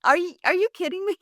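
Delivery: high, fast, incredulous what; reliving the shock, quick and high; retold disbelief. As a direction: sister reacting in disbelief to her brother's remark. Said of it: "I'm like".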